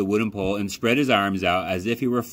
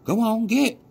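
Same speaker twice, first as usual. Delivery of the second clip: high, loud shoo at the crow; boy shouting; 4 dB louder than usual. A narrator's reading. Boy shouts to chase the crow off the rabbit, high and loud. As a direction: shoo the bird away like a farm boy. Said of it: "the boy flapped his arms and shouted".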